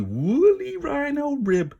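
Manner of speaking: high, slow, savouring gloat. Acted. Kip gloating over his rhino rib, high and slow. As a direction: stretch it out, relishing every word.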